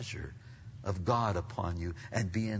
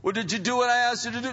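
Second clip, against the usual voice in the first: high, loud, rapid questioning; mock parental demand; 12 semitones higher, 6 dB louder, much faster than usual. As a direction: press the child as an exasperated parent. Acted parent asks, heated repeat.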